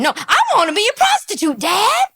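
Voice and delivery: hammed-up daughter telling dad; mock little girl. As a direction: squeak it like a kid announcing a dream. Mocking child answering.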